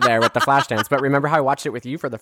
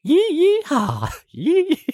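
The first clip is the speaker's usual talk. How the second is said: high, stretched-out yee-haw hollers; mock country band yodel-yelps; yeehaw country singer; slower than usual. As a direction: whoop like a hammed-up cowboy singer. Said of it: "we just, like, go like".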